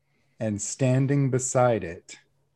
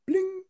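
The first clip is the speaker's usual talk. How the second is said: high ding imitating a panel chime; chime sound effect. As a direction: sing out a little bling.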